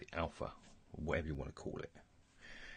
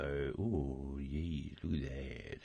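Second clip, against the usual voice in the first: ordinary delivery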